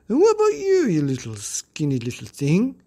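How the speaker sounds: high squeaky taunt; mock the little girl; sneering bully.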